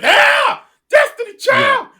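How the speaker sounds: loud, high crowd whoop; cheering shout. Retold or imitated. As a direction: holler it like a hyped crowd. Audience loving the prayer.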